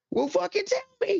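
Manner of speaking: high, slow, mocking squeal at the other driver; mock exasperated retort. Griping to cohosts about an unsignalled truck, high mocking retort. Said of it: "I'm like".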